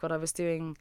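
usual speaking voice

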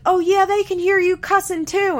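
high, loud retort; mock screaming. [name] snapping back at Corey, high mock screaming. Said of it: "while you're screaming".

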